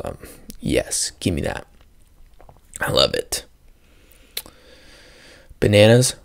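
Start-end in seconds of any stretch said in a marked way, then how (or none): 0.0-3.5 savoring murmur. Pretend. Savoring fruit.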